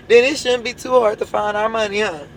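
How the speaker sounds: ordinary talk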